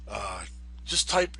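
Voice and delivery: ordinary talk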